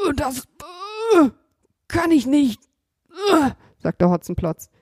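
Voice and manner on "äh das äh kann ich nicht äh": hesitant robber voice with stammers; straining robber. Acted. Tied-up robber tells Kasperl he cannot move, straining.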